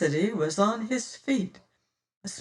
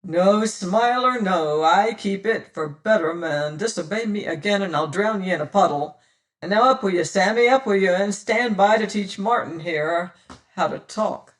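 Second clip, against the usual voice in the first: taunting pirate captain; 5 dB louder than usual. Acted. Pirate captain taunting the terrified boatswain, cruel and mocking. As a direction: savour the threat.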